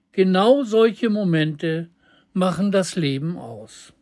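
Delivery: ordinary delivery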